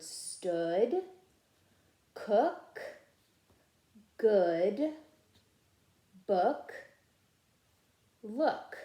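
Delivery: each word said slowly and clearly, long pauses; reading for echo. Shown as a demonstration. Teacher modeling word list for students to echo. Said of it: "I'm gonna read it and you're gonna be my echo".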